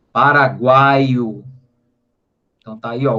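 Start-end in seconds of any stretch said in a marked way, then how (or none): 0.1-1.4 "Paraguaio" drawn out very slowly and louder, leaning on the A; slow example word. Shown as a demonstration. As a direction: dictate "Paraguaio" slowly and firmly, stretching the A. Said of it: "o A é o mais forte". ("Paraguaio" devagar e mais alto, destacando o A)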